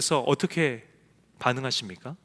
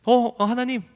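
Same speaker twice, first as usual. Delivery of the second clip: offhand call; 6 semitones higher than usual. Retold or imitated. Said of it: "이라도 부르면 다행이죠".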